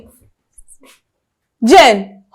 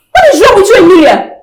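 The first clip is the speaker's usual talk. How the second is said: angry scolding; 6 semitones higher than usual. Acted. Sister scolds drunk Emilia.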